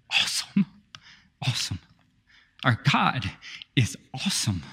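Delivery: hushed, breathy whisper of awe; awed whisper. Real, not acted. Pastor whispers awe at God to congregation, hushed. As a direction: whisper it in worship. Awed worship after scripture.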